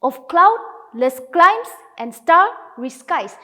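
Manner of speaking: of CLOUDless CLIMES and STARry SKIES, slow and loud on the beats; iambic stress. Shown as a demonstration. Teacher reading the second line aloud, iambic heartbeat stress. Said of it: "That is how we will be reading".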